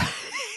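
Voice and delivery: breaking into a laugh mid-read; real laugh. Real, not acted. Host laughing after his wife hears him, a sudden laugh. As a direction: caught out and laughing. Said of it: "the reason I'm laughing".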